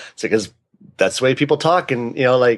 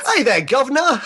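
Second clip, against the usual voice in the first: high-pitched cheeky Cockney English; hammed-up English "governor" greeting; mock English accent; 10 semitones higher than usual. Teasing her about her accent, mock English accent. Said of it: "had an English accent in it".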